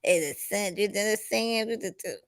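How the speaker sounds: hushed, halting stammer trailing into tiny sounds; Sandra. Retold or imitated. Host recalls an old TV character, soft stuttering impression. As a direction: imitate a sitcom woman's soft, stuttering talk. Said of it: "Who is that used to talk like that?".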